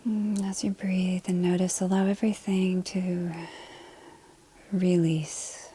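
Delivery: calm body-scan voice, short phrases between long silences; guided meditation. Acted. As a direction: lead them gently through the body, leaving space to breathe.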